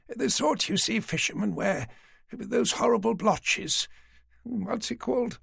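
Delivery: put-on an old lady patiently humouring the police; elderly lady.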